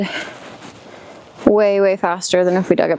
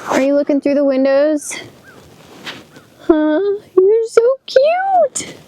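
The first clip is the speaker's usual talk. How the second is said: high cooing voice to a pet; 8 semitones higher than usual. Real, not acted. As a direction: coo at the animal in a high voice. Baby talk to an animal.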